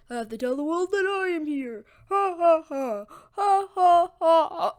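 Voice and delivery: mock-heroic call with booming laughter; heroic proclamation. Deku walking alone playing hero, grandiose. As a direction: announce it grandly, then loud high ha-has.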